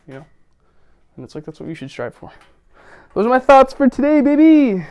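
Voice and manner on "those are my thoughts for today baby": loud, high, exuberant holler; big excited shout to the audience; hyped sign-off. Real, not acted. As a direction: belt it out like a triumphant sign-off.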